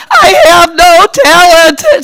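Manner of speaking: drawn-out, high tearful wail; mock crying. Crying child complains to her mom, mock wailing. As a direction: wail it slowly like a heartbroken kid. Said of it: "I'm crying".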